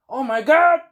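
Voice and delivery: loud, high hammed-up cry; mock horrified shout. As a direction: cry out like a vampire cut in half. Vampire acted being cut in half.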